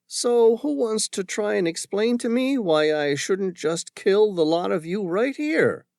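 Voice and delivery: dangerous woman taunting a captured crew; menacing woman. Acted. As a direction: toy with them before the kill. Woman threatens the men.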